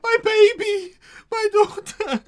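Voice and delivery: high, loud wailing through tears; sobbing cry. Acted. Mother wails to the stranger for her lost daughter, loud high sobbing. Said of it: "stutters and what goes".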